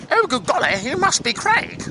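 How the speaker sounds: high, posh English woman; high-pitched plummy English voice; plummy English accent. Acted. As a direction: squeal it with a plum in the mouth. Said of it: "with a real plum in her mouth".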